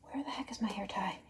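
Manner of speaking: fast unvoiced whisper; whispering to herself. Real, not acted. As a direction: mutter it fast under breath. Looking for her hair tie.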